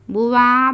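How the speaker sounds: "बुवा" with a long loud "vaa"; wrong stretched vowel. Shown as a demonstration. Teacher warning not to stretch, बुवा dragged long. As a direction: drag out the end of "बुवा" as "buvaaa". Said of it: "बोलकर नहीं खींचना".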